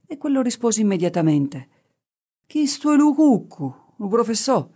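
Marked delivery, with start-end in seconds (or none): 2.4-4.7 high, louder Gallurese shepherd; shepherd's dialect answer. A narrator's reading. Shepherd repeats his confident answer.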